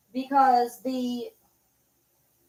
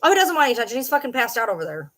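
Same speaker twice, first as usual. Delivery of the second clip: ordinary talk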